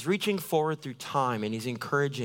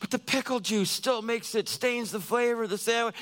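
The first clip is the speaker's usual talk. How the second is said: timid complaint; 8 semitones higher than usual. Retold or imitated. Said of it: "you're just like".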